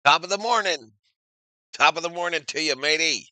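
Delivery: muddled put-on Aussie greeting; mock Australian accent. Streamer trying out morning greetings for London, mock Australian accent. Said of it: "doing Australian".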